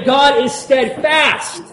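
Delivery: slow, ringing proclamation; slow loud declaration. Shown as a demonstration. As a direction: proclaim it slowly with conviction. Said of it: "Turn to your other neighbor and say".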